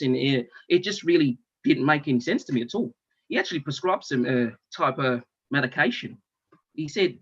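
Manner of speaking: ordinary delivery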